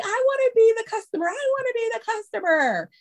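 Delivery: put-on high, quick pleading child voice; eager kids. Kids begging to play customer, high eager pleading.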